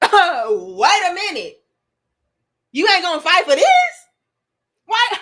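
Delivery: loud, high laughing outrage; laughing disbelief. Retold or imitated. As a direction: laugh in disbelief, then demand. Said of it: "retold indignation".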